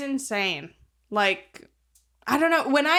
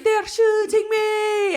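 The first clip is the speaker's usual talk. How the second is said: high, very slow, yelled mock Irish accent; copying the friend's silly Irish accent as he yells in panic; silly Irish accent shout; 12 semitones higher, 4 dB louder, much slower than usual. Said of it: "his impersonation of an Irish accent".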